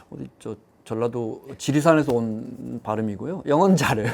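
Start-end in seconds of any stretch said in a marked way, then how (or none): none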